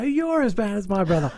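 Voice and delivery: high put-on Chinese accent, cheeky retort; mock Chinese accent. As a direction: fire the line back in a mocking Chinese accent. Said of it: "And they go".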